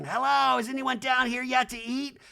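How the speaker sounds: high, lightly voiced Kyle; light high mock Kyle; Kyle impression. Voicing Kyle entering.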